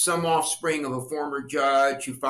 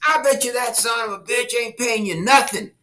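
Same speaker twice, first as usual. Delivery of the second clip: put-on a louder, higher gruff old man, cussing with a grin; voicing the homeowner; 7 semitones higher, 5 dB louder than usual. Homeowner mocking the kids' unknown dad, gruff and louder. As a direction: sneer at the politician like a crusty old neighbor.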